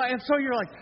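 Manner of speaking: ordinary talk, nothing marked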